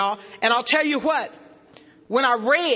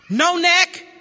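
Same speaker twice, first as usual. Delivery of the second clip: normal talking voice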